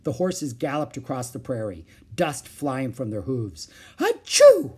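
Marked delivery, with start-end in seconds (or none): 4.0-4.7 very high, loud "achoo"; explosive storybook sneeze; acted sneeze. A narrator's reading. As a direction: burst out a loud child's sneeze. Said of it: "Jack sneezed".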